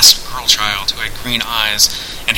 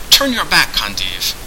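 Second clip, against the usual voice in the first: ordering. A narrator's reading. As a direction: command him coldly. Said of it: "Turgeon ordered".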